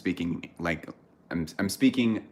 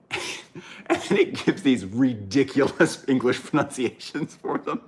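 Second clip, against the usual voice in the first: slow words broken by laughter; laughing through it; 5 semitones higher, slower than usual. Real, not acted. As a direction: crack up mid-sentence. Laughing while describing.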